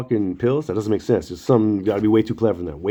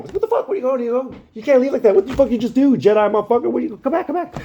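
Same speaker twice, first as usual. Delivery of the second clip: very high-pitched mock panic; freaked-out friends. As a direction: imitate stunned friends squealing after someone leaving. Freaked-out friends beg him to stay.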